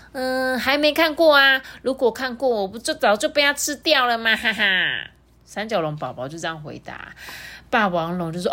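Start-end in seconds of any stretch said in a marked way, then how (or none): 0.1-4.6 put-on high, sweet little-kid voice; a small dinosaur child, earnest and innocent; baby triceratops. Baby answers T-rex.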